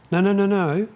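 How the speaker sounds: loud, high rapid "no"s at the dog; sharp no. Real, not acted. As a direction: stop the dog in its tracks. Stopping dog from breaking sit.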